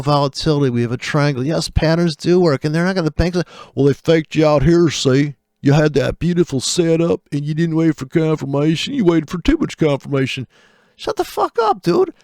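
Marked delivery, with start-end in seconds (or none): 3.7-10.5 preachy mock lecturer, a bit louder; mocking know-it-all. Trader parodying a guru who scolds retail traders. 11.0-12.1 shrill burst, far higher; exasperated outburst. Real, not acted. Trader cutting off the guru in exasperated disbelief.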